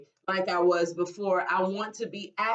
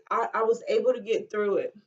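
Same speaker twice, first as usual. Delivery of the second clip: usual speaking voice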